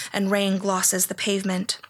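ordinary delivery, nothing marked

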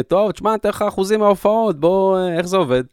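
mock naive partner. Host mocks how they talked to their agent, high mock voice. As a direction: lift it up high, playing the clueless guy asking about percentages. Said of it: "אתם כאילו אומרים".

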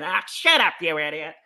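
hammed-up irritated yell, easing off at the end; Mark Levin yelling. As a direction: imitate a radio host's angry outburst. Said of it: "Mark Levin to start yelling".

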